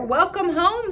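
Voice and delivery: a high, bright-voiced dad welcoming her home; lightly voiced father greeting; cheery father.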